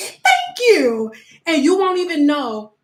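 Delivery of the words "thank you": high, giggly clueless thanks; a giggling mock-grateful thank you; laughing thank you. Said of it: "And you'll be like".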